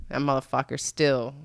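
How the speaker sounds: usual speaking voice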